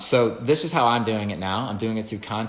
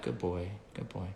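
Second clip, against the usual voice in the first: much softer, hushed praise; 11 dB quieter than usual. Shown as a demonstration. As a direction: murmur it to the dog at your feet. Petting the dog quietly.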